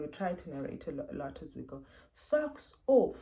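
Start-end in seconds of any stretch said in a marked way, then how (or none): 2.1-3.2 high, loud step-by-step parent voice; narrating to child. Shown as a demonstration. Parent narrating undressing to their child, high and loud. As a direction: announce the action clearly for a little one. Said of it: "narrating a lot".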